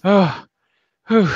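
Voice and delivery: acted "oh" and exhausted whew; sigh of relief. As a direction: let out the breath after great effort. Caster's relief as dome closes.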